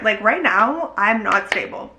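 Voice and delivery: normal talking voice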